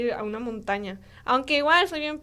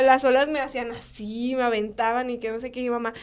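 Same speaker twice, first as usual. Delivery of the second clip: usual speaking voice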